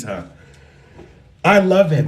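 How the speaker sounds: ordinary talk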